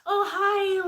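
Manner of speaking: put-on high ditzy valley girl greeting. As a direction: chirp it like a clueless girl. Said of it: "This is how I used to talk".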